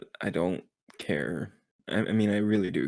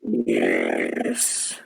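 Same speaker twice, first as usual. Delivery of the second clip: deep voice changer; 14 semitones lower than usual. Pretend.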